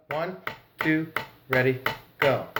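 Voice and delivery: soft, evenly spaced count-in; measured beats, one word each; rhythmic count-off. Real, not acted. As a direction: count the drummers in on the beat. Counting in before playing.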